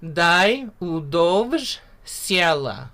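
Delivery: very slow, careful Dai udovj siela; slow pronunciation demo. Shown as a demonstration. Teacher models the line slowly for students. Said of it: "So the first words".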